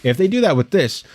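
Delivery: ordinary talk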